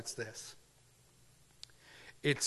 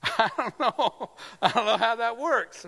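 laughing disbelief; 8 semitones higher, 6 dB louder than usual. Real, not acted. Laughing at Solomon's thousand wives.

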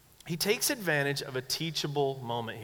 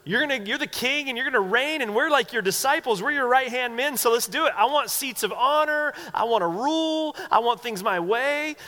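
high, louder mock-eager pleading; eager self-seeking disciples; 8 semitones higher, 4 dB louder than usual. Disciples pressing their king for seats of honor, eager and high-pitched. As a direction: play disciples grabbing at glory, pitched high.

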